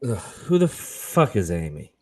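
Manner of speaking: low, slow and groggy; hungover. Acted. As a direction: croak it out the morning after. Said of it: "appearing hungover".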